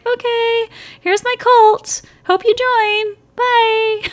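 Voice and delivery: high, loud sing-song brush-off; mock cult recruiter. Host parodying the ex as a cheery cult recruiter, high and loud. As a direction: chirp it like a fake-friendly pitch. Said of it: "It might as well be like".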